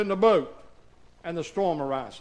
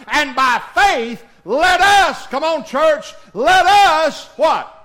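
rising, louder preacher's whoop; full-force preaching; 4 semitones higher, 8 dB louder than usual. Real, not acted. Preacher building to a shout before his church. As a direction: preach it up to the congregation.

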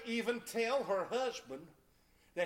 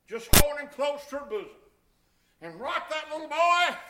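preaching; 7 semitones higher, 14 dB louder than usual. Acted. Preaching at full force.

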